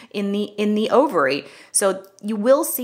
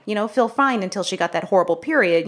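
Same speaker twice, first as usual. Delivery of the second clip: usual speaking voice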